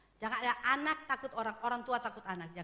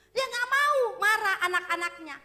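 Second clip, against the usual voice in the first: loud, higher, driving sermon voice; preaching at full force; 6 semitones higher, 10 dB louder than usual. Acted. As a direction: thunder the warning at the congregation. Preaching climax to congregation.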